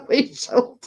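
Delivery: usual speaking voice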